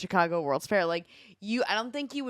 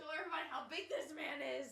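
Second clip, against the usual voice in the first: holding down a pet; 8 semitones higher, 13 dB quieter, faster than usual. Real, not acted. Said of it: "She's wrestling a cat".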